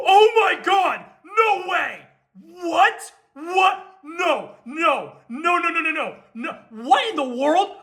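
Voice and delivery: high, excited cries of disbelief, breaking into laughter; can't believe the pull, voice shoots up; stunned disbelief. Real, not acted. Pulls rare card on camera.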